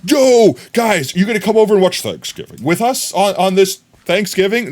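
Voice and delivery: high, loud excited invite; loud cheerful yo; hyped friend. Pretend. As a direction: hype the party invite.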